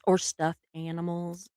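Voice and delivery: normal talking voice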